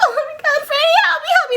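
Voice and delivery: very high hammed-up cry for help; mock panicked plea. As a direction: squeal like a damsel in distress. [name] calls for help.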